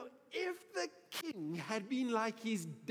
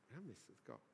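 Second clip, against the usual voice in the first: very soft, low repeat; hushed warning; 10 semitones lower, 16 dB quieter, much faster than usual. Real, not acted. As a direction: let the warning sink in, hushed. Repeats the warning, much softer.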